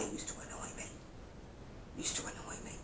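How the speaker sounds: breathy hushed aside, slowing on the repeat; whisper. Real, not acted. Grumbling about her ex's gravy-stained ties, in a whisper. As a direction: confide it under your breath.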